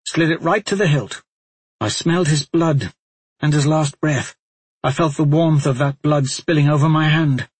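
lightly voiced tormented warrior; grim Talmadge confession.

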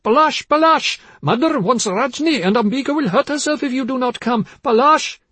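high, loud frantic calling; crying out. A narrator's reading. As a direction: call desperately for your husband upstairs. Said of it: "her mother cried".

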